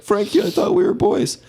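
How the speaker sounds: high-pitched mock pleading; imitated tearful freak-out. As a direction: plead like a betrayed friend, half-acted crying. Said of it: "freaking out, crying".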